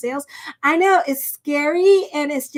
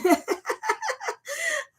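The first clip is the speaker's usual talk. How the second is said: a high breathless giggle, quiet; high squealing laugh; 5 dB quieter than usual. Real, not acted. Tarot reader laughing at her own joke, high squealing giggle.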